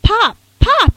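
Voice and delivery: high, loud yelling of his name; excited yell. Retold or imitated. Little girl running out to greet her dad, loud high yell. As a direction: call out to him, thrilled. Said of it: "I yelled".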